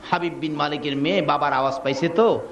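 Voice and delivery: ordinary delivery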